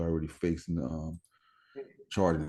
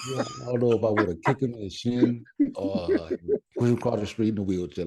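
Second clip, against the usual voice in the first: laughing while talking; 4 semitones higher, 4 dB louder than usual. Real, not acted. Joking about Reggie.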